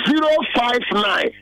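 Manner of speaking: slow, spaced-out phone number for listeners to note; slow dictation. Real, not acted. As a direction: read out digit by digit, slowly.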